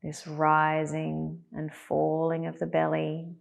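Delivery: spacious, unhurried guidance, letting each phrase rest; guided meditation. Acted. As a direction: settle them calmly into body and breath.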